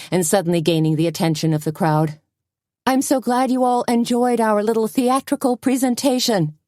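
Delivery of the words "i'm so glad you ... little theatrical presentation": high theatrical announcer voice; improvised showman. A narrator's reading. Suzanne improvising to the crowd, high theatrical host voice. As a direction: ham it up as the host. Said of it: "winging it".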